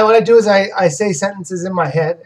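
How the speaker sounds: usual speaking voice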